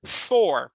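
Four ending in a rolled-back country R, "forr"; country R demo. Shown as a demonstration. Teacher modeling the country R in Four. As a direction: give Four a strong back-of-mouth caipira R. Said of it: "esse R de matuto".

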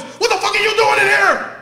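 high, loud booming demand. Retold or imitated. Homeowner catching an intruder in his basement, booming. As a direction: boom it like an angry homeowner. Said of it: "The man has this booming ass voice".

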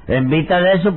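ordinary talk

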